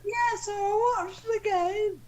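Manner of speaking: high warbling falsetto dame; Dame Edna. Acted. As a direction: put on a high old-lady showbiz voice. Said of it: "You're meant to be Dame Edna Everage".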